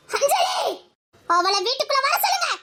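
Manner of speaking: high, sharp call of the name; angry shout. Acted. Ordering Anjali back into the house, angry shout. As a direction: shout the girl back indoors.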